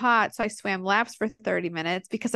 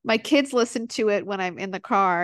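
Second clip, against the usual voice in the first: normal talking voice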